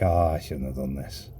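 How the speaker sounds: low, deflated self-quote; regretful. Retold or imitated. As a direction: replay a sinking moment of mild regret. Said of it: "it was instant regret".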